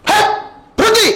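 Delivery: high sudden yell to halt someone; shouting stop. Retold or imitated. Villagers yelling at the preacher to stop, sudden high shout.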